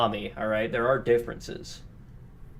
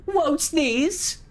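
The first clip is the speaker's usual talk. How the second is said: mock cutesy baby talk. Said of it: "saying it to a woman".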